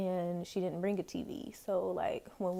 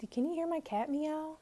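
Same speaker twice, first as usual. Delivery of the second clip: ordinary talk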